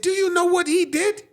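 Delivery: high, mock-outraged complaint; retold indignant accusation. Retold man accusing boy to granny.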